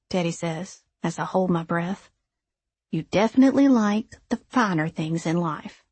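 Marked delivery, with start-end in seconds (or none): none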